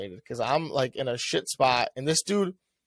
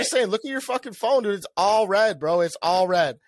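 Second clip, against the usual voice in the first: high, louder, insistent retelling; exasperated arguing; 8 semitones higher, 4 dB louder than usual. Retold or imitated. Passenger telling Uber driver his route is red, exasperated. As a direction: argue your case in heated exasperation.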